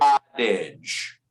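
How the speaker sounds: "Cottage" said low and slow, American flap; model word, low. Shown as a demonstration. Teacher modeling a word for the student, low and slow. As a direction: drop the pitch on "Cottage". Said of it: "this is cottage".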